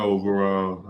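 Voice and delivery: usual speaking voice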